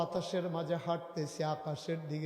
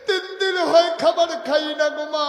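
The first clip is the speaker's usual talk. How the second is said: very high, loud acted wail; crying child; 11 semitones higher, 14 dB louder than usual. Starving child begging mother for food, loud crying wail. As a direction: wail it out at full voice, tearful. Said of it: "বাচ্চারা কাঁদতেছে".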